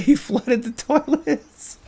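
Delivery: slow words broken by laughter; laughing. Real, not acted. Laughing at an inmate who flooded toilets. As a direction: laugh through the line.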